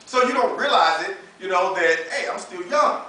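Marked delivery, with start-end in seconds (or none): none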